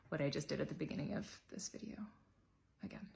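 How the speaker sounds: fast, hushed whisper; quick sheepish whisper; whispered aside. Real, not acted. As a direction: slip it out under your breath. Sheepish promise trailing off.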